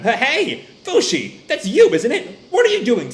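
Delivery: high, alarmed questioning, lightly acted; urgent calling out. Recapper as Gugu pulling Fushi off punks, urgent. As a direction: break up a fight in a hammed-up voice.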